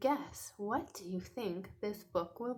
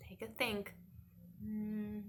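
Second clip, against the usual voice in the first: normal talking voice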